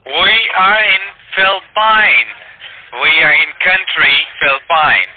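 "Philippine" drawn out as "Fill-pine"; mispronounced Philippines. Real, not acted. Scammer spelling out a false location, "Philippine" slowly mispronounced "Fill-pine". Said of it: "a place that you cannot pronounce".

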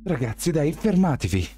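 high pleading cry to stop; pleading alarm. A narrator's reading. Shelby begs the boys to stop fighting, high pleading. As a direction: beg them to stop.

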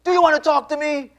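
high, loud imitated shout; demanding tone. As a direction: call it out sharply as the woman. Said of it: "with that tone".

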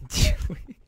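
breaking into laughter mid-line; can't hold the ASMR voice, laughing; laughing through ASMR. Pretend. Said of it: "you're gonna make me laugh".

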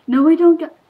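high, very loud outburst; dismayed yell. Real, not acted. Player losing mid pep talk, dismayed yell. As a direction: yell it as the plan falls apart.

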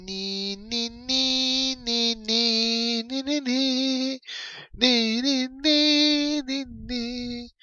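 high, soft, slow ni-ni babble; silly ni chant. Pretend. Fan mocking his own slow brain, high soft ni-ni babble. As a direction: babble like a broken toy. Mock stammering nonsense syllables.

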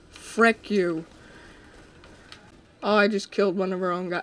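ordinary talk, nothing marked

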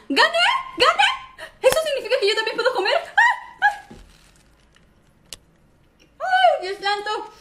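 high loud laughing cheer; excited victory shout. Acted. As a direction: shriek with joy at winning. Wins the contest, cheering.

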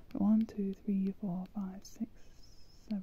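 fading whispered counting; whispering. Real, not acted. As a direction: count it under your breath. Counting spaces under her breath.

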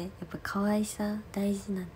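soft whisper, half to herself; whispered aside. Real, not acted. Idol confiding to fans that cuteness matters, whispered. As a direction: breathe it out as a playful secret.

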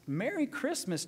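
high, bright holiday call; joyful greeting. Retold or imitated. Pastor acting George greeting the town, high and joyful. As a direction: call it out to passersby.

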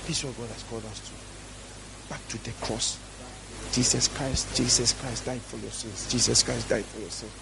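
quiet, earnest reminder of the cross; hushed solemn preaching. Real, not acted. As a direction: drop low and soft, measured.

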